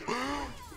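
short high burst of laughter. Real, not acted. Laughing after the shock.

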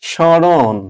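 স্মরণ said as shshoron, nasalised, no m; teacher sounds out স্মরণ with a doubled sh; demo pronunciation. Shown as a demonstration. Teacher shows students how স্মরণ is said. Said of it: "চন্দ্রবিন্দু দিয়ে বোঝাবো".

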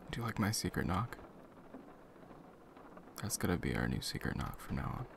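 normal talking voice

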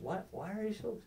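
soft, high, puzzled asking; mock innocence. Apologist repeating his best question to someone upset, softly innocent.

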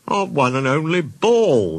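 slow, high-pitched lament; mournful, stretched-out wail; sad. A narrator's reading. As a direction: draw it out in storybook dismay. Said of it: "they said sadly".

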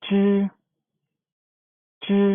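จื้อ said with the tongue curled back, loud and clear; retroflex zhi. Shown as a demonstration. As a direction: curl the tongue for จื้อ, model it for the class. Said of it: "เป็นม้วนลิ้น ม้วนลิ้น".

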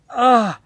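loud, high ugh of exasperation; short, loud groan of disgust; exasperated groan. Real, not acted. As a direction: groan out your frustration. Ranting about the stock market.

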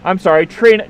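ordinary talk

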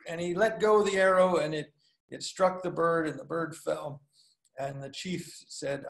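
ordinary delivery

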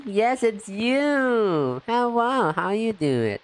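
high, slow drawn-out yes; joyful hello; delighted recognition. Real, not acted. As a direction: greet a familiar face with delight. Recognises a viewer in chat.

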